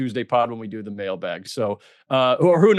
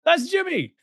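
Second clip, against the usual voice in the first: high, excited reveal; excited punchline; 14 semitones higher than usual. Real, not acted. Podcaster revealing Jimmy Butler as McClane, high and excited.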